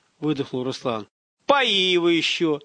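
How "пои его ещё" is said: slow, higher-pitched gruff command; gruffly tossed-off command; gruff grunted order. A narrator's reading. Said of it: "буркнул старший".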